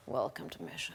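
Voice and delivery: hushed low breathy movie-star line, no voice; low unvoiced intense hero welcome; Tom Cruise. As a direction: whisper it like an intense action hero. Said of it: "in the Tom Cruise kind of way".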